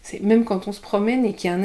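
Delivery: ordinary talk, nothing marked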